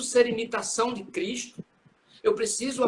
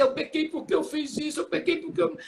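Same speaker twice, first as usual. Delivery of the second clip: higher-pitched mock penitent listing sins; mock confession; 6 semitones higher than usual. Penitent listing sins to the priest, mock confession in a higher pitch. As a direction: imitate a penitent rattling off excuses, pitched up. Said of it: "Não precisa dizer".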